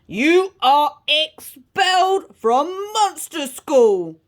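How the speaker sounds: loud, slow ogre headmaster; booming ogre. A narrator's reading. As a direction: boom out the sentence word by word. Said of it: "boomed Mister Ogre".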